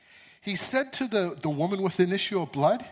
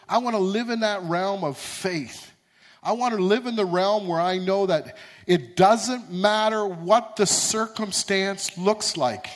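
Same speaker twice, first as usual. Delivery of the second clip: preaching at full force; 2 semitones higher, 6 dB louder than usual. Acted. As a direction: preach it out, building to the peak.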